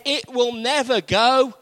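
a mocking sceptic's pronouncement; mock confident doubter. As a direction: play the know-it-all who says it won't work.

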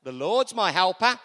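loud confident declaration. Shown as a demonstration. Preacher modelling the declaration for the church, loud and sure. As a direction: declare The Lord's my helper loudly and firmly. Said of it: "So we say with confidence".

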